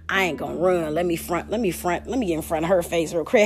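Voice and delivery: low, soft acted aside; scheming self-talk. Faker plotting to front to her, low aside. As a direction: talk to yourself low and softly. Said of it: "they trying to feel like".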